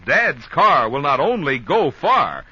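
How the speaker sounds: ordinary talk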